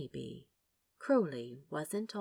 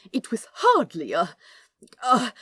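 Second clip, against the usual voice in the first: louder, halting angel; flustered stammer; 4 semitones higher, 11 dB louder than usual. Acted. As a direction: trip over the words, embarrassed.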